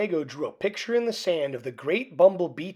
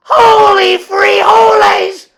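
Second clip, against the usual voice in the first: much higher and louder, stretched shout; shouted cry; 14 semitones higher, 13 dB louder, slower than usual. A narrator's reading. Skipito shouts as he thrusts his sword at the bandit. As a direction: yell it as you thrust the sword. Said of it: "cried Skipito".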